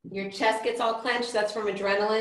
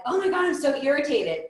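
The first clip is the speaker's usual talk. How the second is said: higher-pitched exasperated outburst; mock irritated; 6 semitones higher than usual. Teacher acts out an irritated person, mock exasperated. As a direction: play someone having an irritable day. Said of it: "irritated one day".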